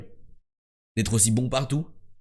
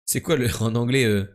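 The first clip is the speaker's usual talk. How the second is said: normal talking voice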